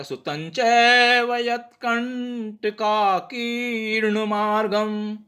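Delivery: slow, syllable by syllable Sanskrit line; slow Sanskrit recitation. Shown as a demonstration. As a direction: recite it slowly, each sound clear. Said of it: "तो ये ऐसा आ जाएगा".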